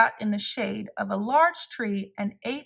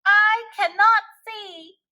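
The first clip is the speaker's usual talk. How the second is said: much higher and louder, slow and desperate; crying out; 10 semitones higher, 6 dB louder than usual. A narrator's reading. Toad blinded by dripping ice cream, slow desperate cry. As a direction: wail that you can't see. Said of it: "cried Toad".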